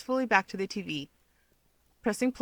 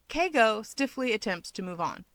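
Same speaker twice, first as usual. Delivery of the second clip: plain reading voice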